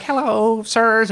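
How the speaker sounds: put-on a polite teen greeting his new bosses; imitating shy newcomer. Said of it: "he's like".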